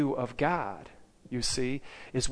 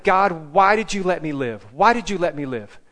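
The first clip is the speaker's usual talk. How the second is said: louder, higher anguished cry to God; retold scream; 4 semitones higher, 8 dB louder than usual. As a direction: imitate a man crying out to God. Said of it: "he started screaming".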